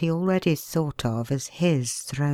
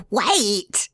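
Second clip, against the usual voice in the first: higher, urgent cry; desperate cry; 7 semitones higher than usual. A narrator's reading. Ponsonby begs the pale man to stay, crying out desperately. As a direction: stop him before he leaves. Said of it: "cried Ponsonby desperately".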